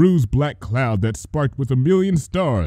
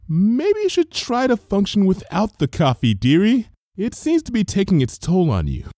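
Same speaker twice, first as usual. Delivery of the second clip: high, cautious suggestion; nervous high baker voice; apprehensive; 9 semitones higher than usual. Acted. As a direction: gently suggest while wary. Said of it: "said apprehensively".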